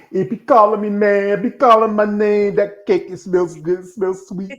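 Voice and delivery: high, loud, drawn-out junkie craving; a hammed-up addict pleading for cake; Pookie craving. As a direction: beg like Pookie smelling his fix. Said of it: "he Pookie, he said".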